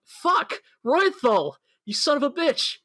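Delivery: high, hammed-up angry outburst; mock furious curse. Reinhardt cursing Roythal over his slashed tires, mock furious. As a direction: curse him out in mock rage.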